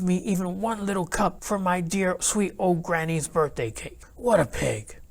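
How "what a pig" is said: plain reading voice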